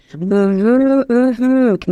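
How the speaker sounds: a savouring mm-hmm, as if tasting chocolate cake; mm-hmm hum. Shown as a demonstration. Coach demonstrating the pitch hum, savouring mm-hmm. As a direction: hum mm-hmm like something delicious. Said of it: "Make sure you're really vibrating your lips".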